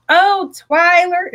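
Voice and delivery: drawn-out, high Southern greeting; playful Southern accent impression. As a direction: imitate a Southern woman, slow and high. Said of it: "in her little Southern accent".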